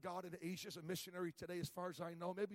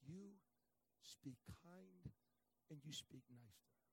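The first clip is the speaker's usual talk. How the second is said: God's whisper; 2 semitones lower, 8 dB quieter, slower than usual. Retold or imitated. God whispering to the preacher at the counter, soft. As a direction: hush it like a still small voice. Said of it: "God whispered and said".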